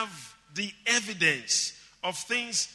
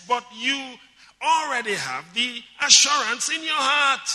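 raised, higher preaching voice; impassioned preaching; 6 semitones higher, 6 dB louder than usual. Real, not acted. Preacher telling believers they already have assurance, impassioned. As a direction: drive each point home to the congregation.

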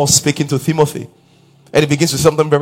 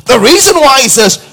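loud, high, driving sermon delivery; fervent preaching; 7 semitones higher, 5 dB louder than usual. Acted. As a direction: preach it out to the whole hall, rising. Preacher building to full force.

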